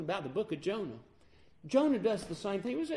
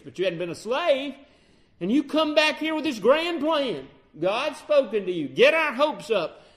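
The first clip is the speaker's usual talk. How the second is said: angry officers berating Moses; accusing officers; 3 semitones higher, 6 dB louder than usual. Retold or imitated. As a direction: throw the blame at him, louder and louder.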